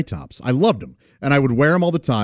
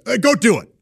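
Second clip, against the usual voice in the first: higher, dismissive mock command; impatient order; 6 semitones higher, 3 dB louder than usual. VP ordering the young employee to the doorman, impatient. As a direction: imitate a boss brushing off objections. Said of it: "he didn't wanna hear that".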